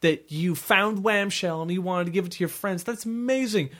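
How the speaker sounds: a mom half-crying over her son; mock gushing mom. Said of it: "She's like".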